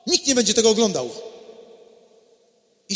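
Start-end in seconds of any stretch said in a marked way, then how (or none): 0.1-1.1 mock shout. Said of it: "krzyczą".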